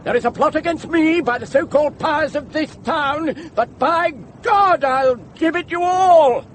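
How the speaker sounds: high, heated outburst building to a cry; furious threat. Acted. Accused plotter-hunter threatens to gibbet them all, furious. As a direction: rage at them, pitched high.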